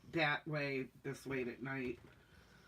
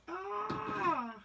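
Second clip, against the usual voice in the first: high excited ah; short high yelp of delight; delighted squeal; 13 semitones higher than usual. Real, not acted. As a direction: squeal at the thought of maple syrup. Excited over maple syrup.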